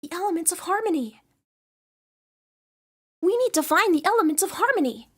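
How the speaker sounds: a fairly close take on an earnest cartoon pony; Twilight Sparkle voice. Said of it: "I could do a really good Twilight Sparkle impression".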